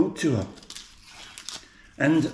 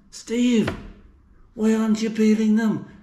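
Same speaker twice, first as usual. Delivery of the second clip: higher-pitched mock-viewer protest; imitated complaining viewer; 5 semitones higher than usual. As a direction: voice a viewer scolding Steve in a raised pitch. Imitates viewers questioning him.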